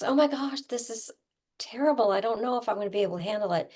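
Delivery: high, rushed, panicky self-talk; panicky anxiety. Shown as a demonstration. Tapping teacher voicing her own panic, fast and high. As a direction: gabble it out like rising panic. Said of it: "anxiety is always sort of hyper".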